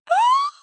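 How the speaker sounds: sharp high gasp of shock; a loud startled intake of breath. Real, not acted. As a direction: gasp as if scandalised. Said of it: "My mouth was flying open".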